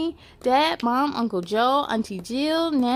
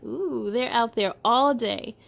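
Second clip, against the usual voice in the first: plain reading voice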